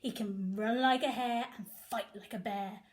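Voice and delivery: usual speaking voice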